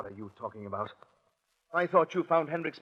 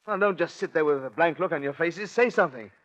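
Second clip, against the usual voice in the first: louder, higher, pushing them to speak; exasperated demand; 5 semitones higher, 7 dB louder than usual. Acted. Killer demands the silent police say something, exasperated and loud. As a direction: snap at them to answer.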